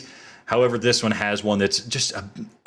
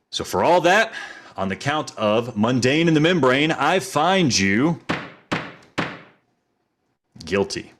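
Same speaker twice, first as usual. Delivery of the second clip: mock judge verdict; 4 semitones higher, slower than usual. Mock courtroom verdict on the game.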